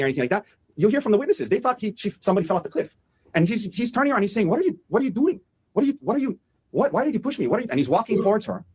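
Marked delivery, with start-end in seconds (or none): 4.5-7.7 higher-pitched, stammered demand for an explanation; mock-startled questioning, pitched up; retold alarmed questioning. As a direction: act out his bewildered protest, repeating the broken question. Retelling startled man's confrontation.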